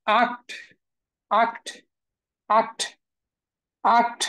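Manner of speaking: "আক্ট" with an open ah before kt; German open A. Shown as a demonstration. Teacher models German eight for students, "আক্ট" with ah. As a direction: say "আক্ট" as ahkt, not ekt. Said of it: "এর যে উচ্চারণ সেটা কিন্তু আ".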